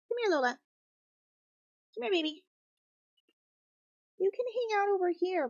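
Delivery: high sing-song call to a pet; calling the cat. Real, not acted. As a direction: coax the cat over, high and sweet.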